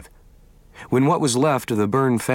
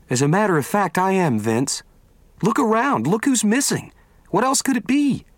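put-on a young woman's higher voice, earnest and sure of herself; Vicky, higher voice; 9 semitones higher than usual. Vicky tells Vince it's all God's doing, higher and earnest. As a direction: voice Vicky as she insists, rising toward the end.

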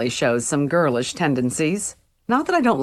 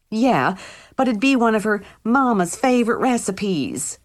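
higher, hammed-up Oklahoma country twang; exaggerated Okie twang; 6 semitones higher than usual. Mimicking a dead friend's voice to Damien, in an exaggerated Okie twang. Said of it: "my best exaggerated Okie twang".

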